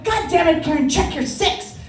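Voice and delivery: loud, high exasperated shout; angry military dad. Retold or imitated. Dad to daughter backing up, angry military shout. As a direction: yell it like an irate pilot. Dad snaps during driving lesson.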